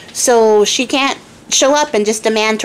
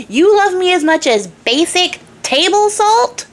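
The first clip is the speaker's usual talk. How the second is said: much higher, slower, peaking outrage; incredulous king; 9 semitones higher, slower than usual. Acted. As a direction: climb to full incredulity. King's escalated indignant question.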